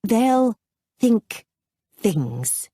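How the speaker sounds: word by word, falling away; deliberate restatement. A narrator's reading. Abby covering her broken-off sentence, deliberate and halting. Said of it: "repeated the sentence as if she'd meant to say it".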